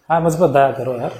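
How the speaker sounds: offhand and low, like chatting with a friend; casual, careless. Shown as a demonstration. Wrong way, praying to God like a buddy, offhand. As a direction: toss the prayer off lazily. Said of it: "ऐसे बोलेंगे?".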